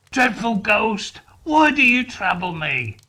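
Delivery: loud, high cry for mercy; terrified plea. Acted. Scrooge begging the shrieking ghost, loud terrified plea. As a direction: beg in terror.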